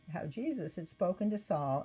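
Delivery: ordinary delivery, nothing marked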